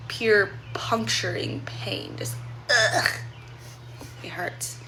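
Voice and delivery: hushed, hurting whisper; pained whisper. Real, not acted. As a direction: whisper it through the pain. Describing painful sore throat.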